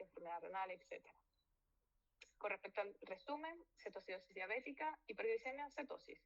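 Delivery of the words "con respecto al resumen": ordinary talk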